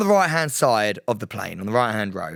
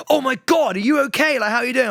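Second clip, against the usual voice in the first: worried agent; 9 semitones higher, faster than usual. Retold or imitated. Agents' worried reply voiced.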